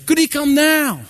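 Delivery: a hint of an eager small girl; lightly voiced little girl. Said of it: "girl asks mother".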